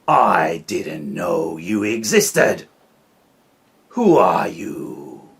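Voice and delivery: loud, slow booming bear voice; slow, loud bear roar; Bear shouting. Acted. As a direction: boom it out like a big grumpy bear. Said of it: "shouted Bear".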